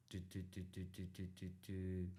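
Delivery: soft, low "do-do-do" while remembering; idle murmured syllables filling a pause; thinking noises. Real, not acted. Trying to recall his opponent's name, soft filler sounds.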